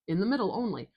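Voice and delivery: usual speaking voice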